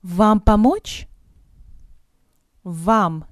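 normal talking voice

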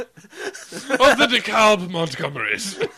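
loud, slow posh British with a laugh; laughing mock upper-class British accent. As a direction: announce the family name like a snobbish aristocrat. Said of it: "British voice".

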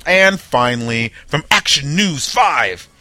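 slow, loud announcer voice; booming newscaster delivery; news announcer read. Shown as a demonstration. Host putting on a big news voice. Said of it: "I feel very important when I say that".

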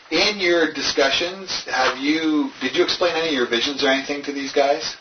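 ordinary delivery

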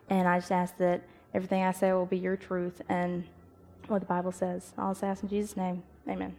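soft, hushed prayer to open the talk. Acted. Young speaker opening her church talk with prayer, softly. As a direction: pray it low and humble, eyes closed.

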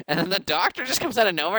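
ordinary talk, nothing marked

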